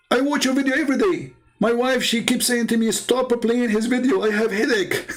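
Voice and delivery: high, gushing imitation breaking toward laughter; high-pitched, amused mock excitement; excited fan. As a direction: gush like a fan to his idol. Acted thrilled fan meeting him.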